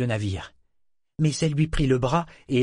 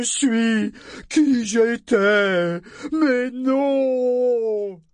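cri aigu, de plus en plus défiant; feral wasteland raider; 9 semitones higher, 4 dB louder, slower than usual. A narrator's reading. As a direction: rugir son refus jusqu'au cri. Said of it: "rugit-il plein de défi". (rugissement de défi dément)

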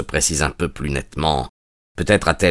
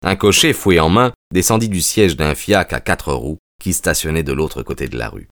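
usual speaking voice

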